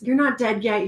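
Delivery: normal talking voice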